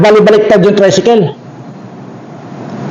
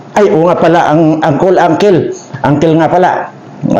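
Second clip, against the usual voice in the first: usual speaking voice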